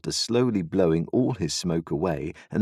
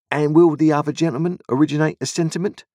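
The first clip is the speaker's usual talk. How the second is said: fast, high, loud sly voice; sly stranger, quick; 9 semitones higher, 6 dB louder, faster than usual. A narrator's reading. The stranger asks Wopsle his drink, fast and sly. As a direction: rattle it off with false charm.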